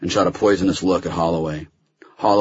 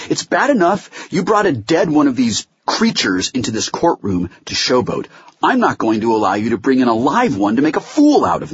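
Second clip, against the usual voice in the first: higher, emphatic scolding from the bench; stern rebuke; 8 semitones higher, 3 dB louder than usual. Acted. Judge reprimands Holloway over the facts, in stern rebuke. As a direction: lay down the law, exasperated. Judge berates lawyer in hearing.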